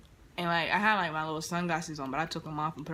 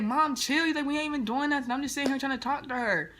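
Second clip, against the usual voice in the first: mimicking the dude, higher; 8 semitones higher than usual. Voicing the dude to his mom.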